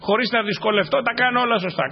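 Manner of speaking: high, loud boastful claim; hammed-up proud show-off; mock boaster claiming he does everything right. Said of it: "ας πει".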